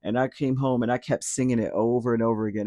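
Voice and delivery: normal talking voice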